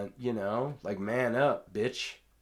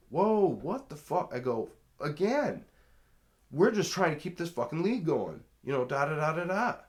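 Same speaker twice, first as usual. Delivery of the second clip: high, loud and fed up; exasperated outburst; 9 semitones higher, 9 dB louder than usual. Retold or imitated. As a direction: yell back at the hecklers. Retold outburst at catcalling players.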